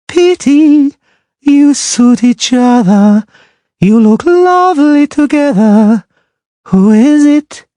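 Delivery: high, slow, singing mermaid mocking the witcher; a proud sea creature sneering in song; scornful mermaid. A narrator's reading.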